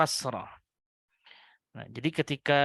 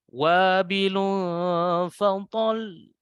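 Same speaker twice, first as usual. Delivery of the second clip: wabilun fatal drawn out, voice lifted on fatal; raised and slow; 4 semitones higher, much slower than usual. Shown as a demonstration. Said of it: "Naikkan ya suaranya".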